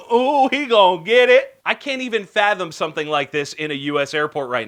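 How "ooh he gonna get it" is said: high, loud, gleeful mock taunt; hammed-up "someone's in trouble" jeer; teasing onlooker. Onlooker watches a traveler pulled aside by TSA, mock taunting.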